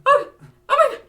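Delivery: high, loud mock panic; hammed-up frightened shriek; mock panicked kid. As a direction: squeal like a terrified kid. Scared kid reacting to stepdad.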